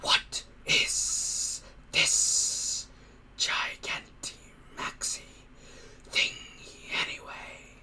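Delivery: slow soft monster whisper rising at the end; a hissing creature, intrigued; curious creature whisper. Acted. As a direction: ask it like a puzzled beast.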